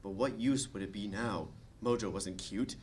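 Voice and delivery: ordinary talk, nothing marked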